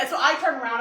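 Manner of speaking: ordinary talk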